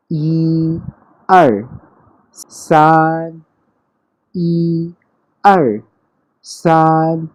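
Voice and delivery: "ई" and "सान" high and level, "आर" falling hard and loud; Mandarin tones. Shown as a demonstration. Teacher adding the third number, clear first and fourth tones. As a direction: hold "सान" high and flat, punch "आर" down. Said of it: "सान के ऊपर भी first tone है".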